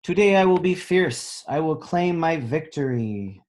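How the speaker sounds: high, loud triumphant reading; bold storybook read. A narrator's reading. Teacher reading a picture book aloud, high and triumphant. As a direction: declare it like a victory.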